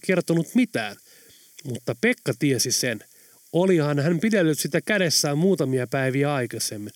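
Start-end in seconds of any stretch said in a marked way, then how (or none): none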